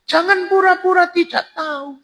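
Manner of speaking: very high falsetto woman; high put-on female voice; woman's voice. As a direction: pitch way up as the woman answering. Voicing the woman in the story.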